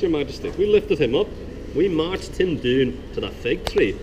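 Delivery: put-on jester's joke in a soft but decisive Scottish accent. Jester telling the king a joke in a Scottish accent. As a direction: spin the yarn in a lively Scottish lilt, rising to a big finish. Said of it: "I'm gonna do a soft, but hopefully decisive Scottish dialect".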